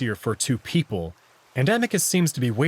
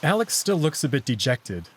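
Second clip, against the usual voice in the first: ordinary delivery, nothing marked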